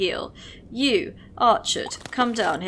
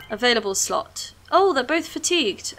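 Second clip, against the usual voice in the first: ordinary delivery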